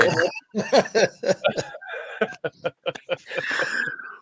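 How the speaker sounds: long real breathy laugh; breathy laughter. Real, not acted. Host still laughing at his friend's joke. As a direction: laugh on and on under your breath.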